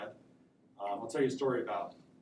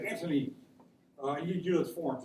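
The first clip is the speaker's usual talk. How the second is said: lisp; 5 semitones higher, 5 dB louder than usual. Retold or imitated. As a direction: imitate a sweet guy with a lisp. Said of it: "he had a lisp".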